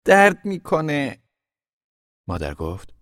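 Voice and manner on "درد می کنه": high, loud, drawn-out "it hurts"; pained high-pitched complaint; cry of pain. Acted. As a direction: wail it slowly as if hurt. Child cries out in pain.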